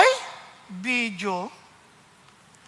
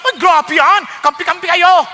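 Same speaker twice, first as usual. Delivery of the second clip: 1 mock indignant wife; 4 semitones higher, 7 dB louder than usual. Quoting angry wife.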